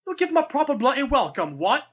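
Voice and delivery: high-pitched, hammed-up English accent; badly faked English accent. Co-host retries Scarlet's welcome line, badly faked English. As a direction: attempt a terrible English accent. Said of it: "I have to try that one over again".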